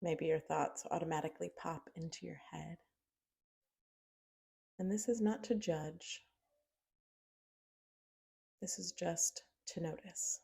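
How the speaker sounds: unhurried meditation guide with long pauses; soft-spoken, spacious mindfulness prompt; guided meditation. Acted. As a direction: guide them gently inward, leaving long silences.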